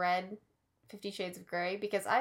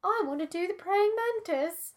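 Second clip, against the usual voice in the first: high, breathy granny voice; old lady voice; 10 semitones higher than usual. Acted. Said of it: "Just your old lady voice?".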